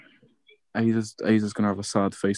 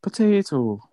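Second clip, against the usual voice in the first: higher-pitched mock Irish accent; put-on Irish accent; 7 semitones higher, slower than usual. Winding up mates over Ireland in a mock Irish accent. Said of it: "You like your accents".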